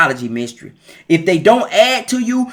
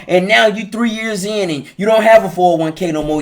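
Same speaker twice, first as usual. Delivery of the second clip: ordinary talk, nothing marked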